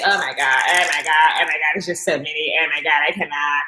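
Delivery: low, groaning mock despair over the pills; imitating her old dread, low and dragging; overwhelmed groaning. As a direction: moan it like it's all too much. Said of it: "And I would say".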